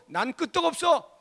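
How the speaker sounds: high, loud boast; acted show of unshakeable nerve; defiant bravado. Strong-hearted person shrugging off ten warnings, loudly defiant.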